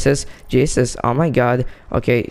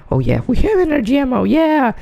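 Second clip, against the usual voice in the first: excited find; 12 semitones higher, faster than usual. Real, not acted. Finds the ammo he needed.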